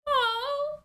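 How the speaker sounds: usual speaking voice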